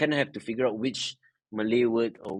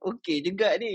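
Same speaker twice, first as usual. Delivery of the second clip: mock pleased reaction; 9 semitones higher than usual. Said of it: "I'm like".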